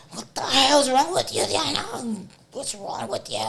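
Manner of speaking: hammed-up mother shouting at her daughter; mom yelling. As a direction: snap at her like an alarmed mother. Mom voiced yelling in story.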